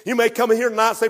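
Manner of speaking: ordinary talk, nothing marked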